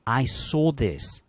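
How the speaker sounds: usual speaking voice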